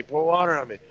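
imitating the plea again, pitched high; mock scream repeated. Stung soldier begging again for water, mock high cry. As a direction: echo the panicked cry, lightly. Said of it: "he hears this one guy screaming".

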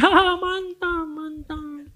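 high, slow, laughing "mantap"; laughing crowd. Retold or imitated. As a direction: mimic a giggling congregation. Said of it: "Mereka gini".

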